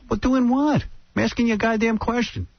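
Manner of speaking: frustrated son pressing father; exasperated son. Retold or imitated. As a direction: son pushing back at dad. Son arguing with dad.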